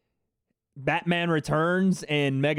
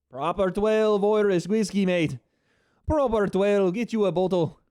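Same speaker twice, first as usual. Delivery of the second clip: put-on Irish accent, higher; mock Irish ad voice for the whiskey; a playful stab at an Irish accent; 4 semitones higher than usual. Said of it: "How's that Irish accent?".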